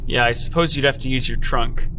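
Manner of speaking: ordinary delivery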